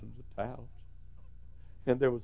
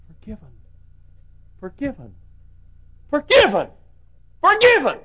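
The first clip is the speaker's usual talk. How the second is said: building to a shout; 11 semitones higher, 15 dB louder than usual. Retold or imitated. Preacher voicing the forgiven man shouting joy. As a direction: swell from a murmur to a cry.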